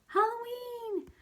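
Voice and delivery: the whole class yells it out high; class shouting. Acted. The class answering Mister E, high slow shout. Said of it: "everyone else shouted".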